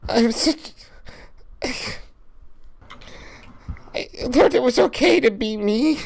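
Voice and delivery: grieving, close to tears. Acted. Lucha tells Hunter about his lost friend, near tears. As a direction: voice cracking, halting and broken.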